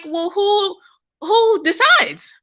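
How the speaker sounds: high, drawn-out questioning; incredulous challenge. Retold or imitated. Speaker challenging who sets racial labels, incredulous.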